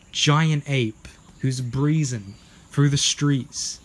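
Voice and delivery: ordinary delivery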